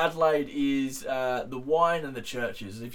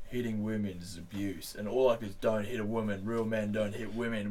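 deep Australian ad voice; 6 semitones lower, 9 dB quieter than usual. Retold or imitated. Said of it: "You do it in the accent?".

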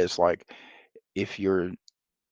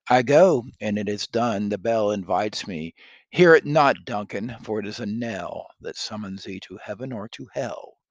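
loud, declaiming Macbeth; dramatic Shakespeare reading; 2 semitones higher, 8 dB louder than usual. Acted. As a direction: proclaim it like a stage tragedian.

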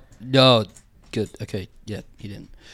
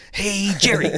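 Kramer impression; 3 dB quieter than usual. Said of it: "that's Kramer".

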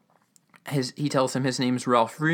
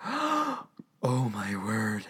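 soft awed exclamation; imitated quiet astonishment; hushed amazement; 2 semitones lower, 5 dB quieter, much slower than usual. Eugene amazed by the piggy bank.

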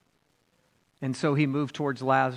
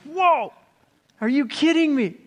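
much higher, louder astonished whoa; amazed; 12 semitones higher, 6 dB louder than usual. Retold or imitated. Pastor imagining seeing Lazarus walk out, astonished. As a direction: gasp it out like you can't believe your eyes. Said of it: "I would have been like".

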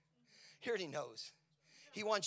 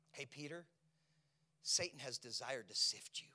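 whispered warning; 4 semitones lower than usual. Acted. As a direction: lean in and warn in a whisper. Jesus warning Peter.

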